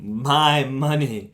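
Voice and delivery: MY MONEY drawn right out, high and loud; proud emphasis. Real, not acted. Proudly claims his first wages.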